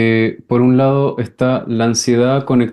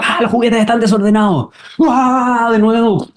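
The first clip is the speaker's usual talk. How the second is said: much higher, alarmed outcry over the mess; mock alarm; 9 semitones higher than usual. Anxious mother finds the toys messy again, alarmed high cry. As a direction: cry out in sudden panic. Mom suddenly anxious, voiced.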